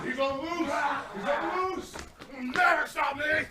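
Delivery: a mocked protester moaning in slow falsetto; slow mock wail. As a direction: stretch every word into a drawn-out high wail. Mocking screaming crowd.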